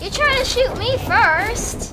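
high, loud accusing; heated. Real, not acted. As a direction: throw the blame at him. Heated argument.

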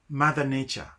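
normal talking voice

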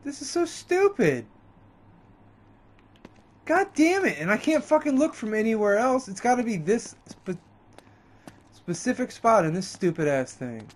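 high-pitched frustrated outburst; exasperated. Real, not acted. Stuck on puzzle, cursing game.

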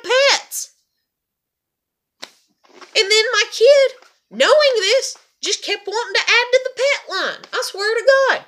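very loud, high-pitched venting; real heated frustration; exasperated rant. Real, not acted. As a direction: vent at full volume, voice pitched high. Exasperated rant about pets.

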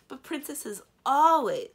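high, loud, drawn-out protest; bewildered protest. A narrator's reading. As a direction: object loudly in baffled disbelief. Knight protests in acted dialogue.

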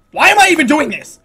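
high, loud exasperated question; frustrated wail. Real, not acted. Player questioning the whole effort, loud and exasperated. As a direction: pitch it high, fed up.

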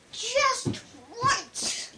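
high, loud, eager piglet thought; lightly acted piglet voice; piglet's thought. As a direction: punch it out high, word by word.

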